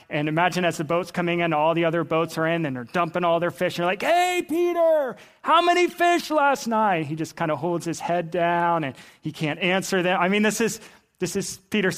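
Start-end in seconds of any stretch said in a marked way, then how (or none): 4.0-7.1 high, drawn-out hail; calling out. Acted. Fishermen hailing Peter as boats come in, calling out high. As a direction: holler it slowly across the water.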